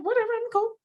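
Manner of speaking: high-pitched dismissive imitation; mock nonchalance. Imitating him before he wanted anyone, mock indifference. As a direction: toss it off in a high voice.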